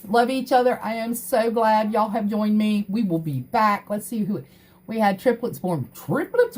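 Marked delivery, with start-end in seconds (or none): 5.9-6.6 high, incredulous question; shocked disbelief at the news; squeaked disbelieving question, much higher; surprised. Real, not acted.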